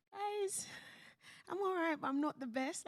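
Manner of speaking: high, light-hearted confession to the group; confiding to friends. Retold or imitated. New mum telling her friends she's not the best, high and light.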